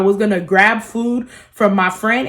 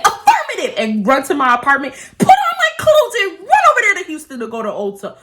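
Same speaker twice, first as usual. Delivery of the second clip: high, squeaky mock-eager yes-man voice; hammed-up chirpy obedience; mock eager employee; 7 semitones higher than usual. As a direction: sarcastically play the overeager worker. Mocking eager reply to boss.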